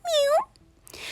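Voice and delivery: high, thin little meow; quick high-pitched kitty meow; cat meow. Acted. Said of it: "And Kitty said".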